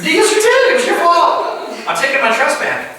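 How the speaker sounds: high, playful scolding; mock accusing. Preacher blaming the volunteer for the fire, mock scolding. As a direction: point the finger, hammed up.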